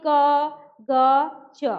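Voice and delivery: क, ग, च said slowly and crisply with no breath of h after them; teacher sounding out क, ग, च with no h-breath; slow unaspirated letters. Shown as a demonstration. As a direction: spell out क, ग, च slowly, each one clean and unaspirated. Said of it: "आप च पढ़ेंगे तो कैसे पढ़ेंगे".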